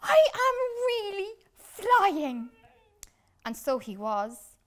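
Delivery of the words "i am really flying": much higher and louder thrilled cry; delighted shout, much higher; excited. Acted. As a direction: burst out with joy. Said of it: "said Flip-Flop excitedly".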